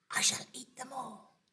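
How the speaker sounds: crocodile's hushed, greedy whisper; sly whisper. Acted. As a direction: whisper it so the children can't hear. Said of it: "he said to himself".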